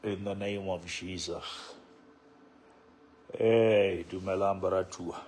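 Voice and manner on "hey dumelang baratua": ordinary delivery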